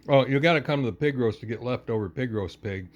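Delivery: ordinary delivery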